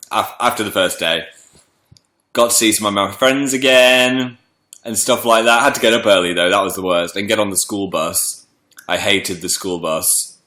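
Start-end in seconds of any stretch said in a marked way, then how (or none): none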